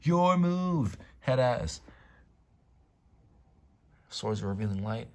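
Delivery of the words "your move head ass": mock duelist taunt.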